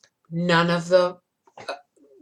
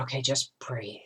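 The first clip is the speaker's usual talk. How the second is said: breathless whisper, calming herself down; 5 semitones lower, 10 dB quieter than usual. Retold or imitated. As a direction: whisper it to get through the pain. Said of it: "I thought".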